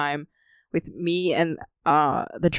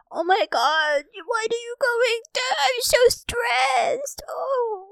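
much higher, slow, panicked wailing cry; mock panicked cry; 3 dB quieter, slower than usual. Friend imitates passenger panicking on highway, hammed-up wail. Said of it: "he'd be like".

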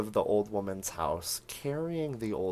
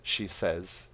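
ordinary delivery

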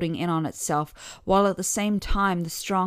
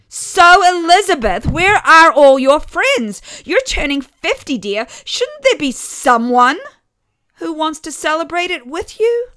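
much higher and much louder, sweetly pointed; needling mother; 11 semitones higher, 15 dB louder than usual. A narrator's reading. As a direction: rub the salt in with a smile. Mother needles her daughter.

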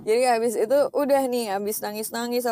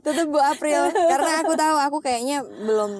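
normal talking voice

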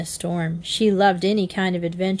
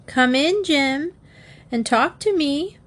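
higher-pitched insistent little plea; 8 semitones higher than usual. A narrator's reading. Bobby begging Jim to come in and talk, insistent. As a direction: coax him inside, voice lifted high. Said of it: "Bobby insisted".

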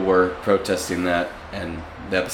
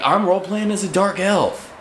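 character voice; 10 semitones higher than usual. Retold or imitated. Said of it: "And he's just like".

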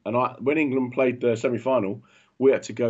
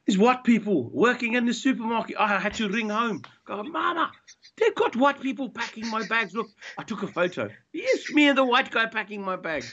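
put-on friend's Zimbabwean accent, pitched up and excited; 9 semitones higher than usual. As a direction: retell the call home in a Zimbabwean accent, higher. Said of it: "speaking with a Zimbabwe accent".

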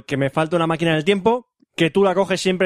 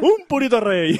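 put-on slow, higher, laughing Cuban accent; 5 semitones higher, slower than usual. Joking Caesar offers a cigar, Cuban accent. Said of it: "te lo digo en acento cubano".

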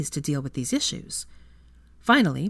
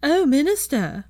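higher, slow, swooning falsetto; mock falsetto; 10 semitones higher, 6 dB louder, slower than usual. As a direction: simper at the minister in a fake high voice. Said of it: "in a high falsetto".

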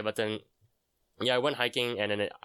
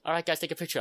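mom voice; 8 semitones higher, faster than usual. Retold or imitated. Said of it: "my mom was like".

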